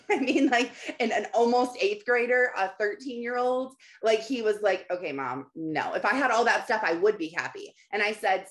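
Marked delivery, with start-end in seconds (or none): 4.9-5.9 low, quiet dismissive reply; dismissive teen son. Retold or imitated. Thirteen-year-old answering his mom, dismissive. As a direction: mildly imitate a son brushing off his mom.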